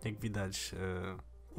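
normal talking voice

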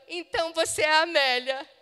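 loud, eager question with a rising end; calling out in happy disbelief; a stranger thrilled to find her; excited recognition. Retold or imitated.

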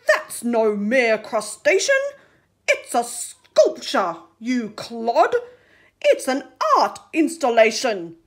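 lofty, loud scolding; loud indignant shark. Acted. Shark correcting the octopus, indignant. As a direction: lecture the clod about art.

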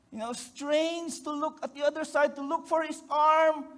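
ordinary talk, nothing marked

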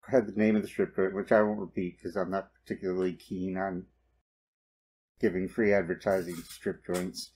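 ordinary talk

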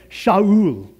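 "Shaul" drawn out in two syllables, sha-OOL; Hebrew pronunciation. Shown as a demonstration. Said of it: "If you heard that in Hebrew".

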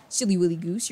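normal talking voice

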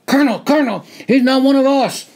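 high, loud desperate scream. Acted. Captain screaming to the colonel while grabbing the collar. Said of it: "The captain screamed".

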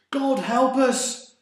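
loud, high, drawn-out cry for help. A narrator's reading. Sailor praying in the storm, crying out. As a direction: call out desperately, high and slow. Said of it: "cried a sailor".

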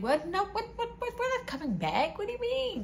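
high-pitched, rapid string of baffled questions; incredulous. Retold or imitated. Woman answering the caller asking them back, high incredulous protest. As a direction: squeal in disbelief, repeating yourself.

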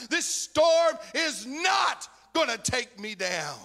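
high, loud and slow, each word of not stretched and shouted; slow shouted refrain. Acted. Preacher's last shout to congregation over the storm, slow. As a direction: drag the line out as a roaring final cry.

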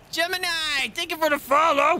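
excited thanks. Real, not acted. Thanking follower.

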